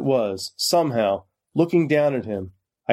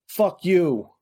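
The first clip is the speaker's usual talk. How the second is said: higher, mushy words through a broken mouth; teeth missing; 7 semitones higher than usual. A narrator's reading. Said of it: "sounding different with the teeth missing".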